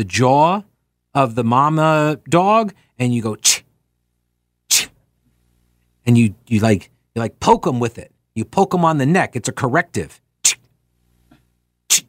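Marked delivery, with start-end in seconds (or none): 3.4-4.9 short, sharp "ch" noise; imitated dog-trainer "ch". Shown as a demonstration. 10.4-12.0 short, sharp "ch" correction; one quick "ch", then another; imitated dog-trainer "ch". Shown as a demonstration.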